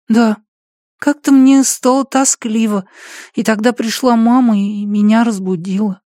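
put-on high child's voice; small boy telling a dream; little boy voice.

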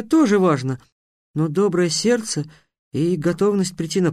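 a hint of a timid girl; shy girl voice. A narrator's reading. Tiana's hesitant answer.

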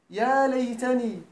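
"يا ليتني" drawn out slowly; lingering on the Quranic words; slow reverent citing of the verse; slow quotation. Shown as a demonstration. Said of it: "quoting Quran as example".

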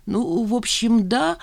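a touch of a sob, mumbled; mild sobbing mumble. Acted. Mother giving in to Nina, mild sobbing mumble. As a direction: mumble it through tears, lightly. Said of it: "всхлипывая, пробормотала".

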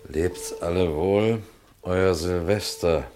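slow, weighty reading of the note; the detective slowly reads out the dead man's farewell note; slow reading aloud. Acted. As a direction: read each word out slowly and gravely.

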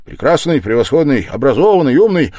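loud, high, lively string of praise; animated patter. A narrator's reading. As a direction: reel off the praise with sudden animation. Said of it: "неожиданной скороговоркой и с каким-то необыкновенным".

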